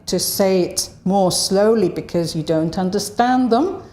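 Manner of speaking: ordinary delivery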